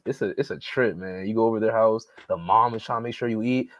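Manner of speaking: normal talking voice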